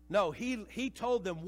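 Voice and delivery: usual speaking voice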